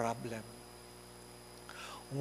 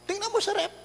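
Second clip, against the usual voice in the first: mother's answer; 3 dB louder, much faster than usual. Retold or imitated. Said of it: "Ano sagot ng nanay?".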